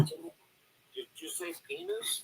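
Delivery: can't believe what he heard; slow stunned squeak; incredulous. Real, not acted. Disbelief at misheard word.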